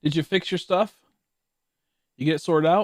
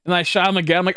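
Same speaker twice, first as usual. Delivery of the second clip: usual speaking voice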